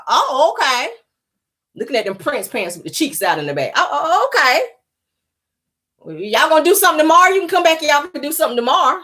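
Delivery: overly keen, inviting her back; mock Simon. Mocking Simon inviting Portia back, high and eager.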